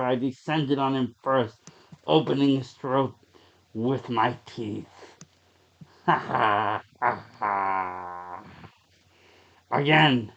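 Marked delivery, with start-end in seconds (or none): 6.1-8.4 low, slow sinister chuckle; evil laugh. Acted. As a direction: gloat with a dark villain's laugh. Vampire narrator gloats after killing.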